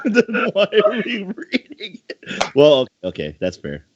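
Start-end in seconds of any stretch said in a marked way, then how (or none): 0.0-2.5 laughs through the question, high and slow; amused disbelief while laughing. Real, not acted. Laughing question at odd entry.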